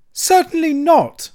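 very high, loud, drawn-out refusal; emphatic. A narrator's reading. Toad refusing the badger's promise, emphatic. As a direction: refuse flatly at the top of your voice. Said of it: "replied Toad emphatically".

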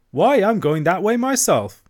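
brisk, hearty countrywoman voice; barge woman. A narrator's reading. Barge woman offering the washerwoman a lift, hearty. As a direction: sound helpful and sure of the way.

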